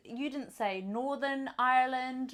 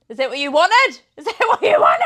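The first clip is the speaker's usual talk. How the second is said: raised, high mock-indignant yell; mock outburst; 7 semitones higher, 15 dB louder than usual. Podcaster showing friends her photos, mock outburst. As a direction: shout it at them, louder on the repeat.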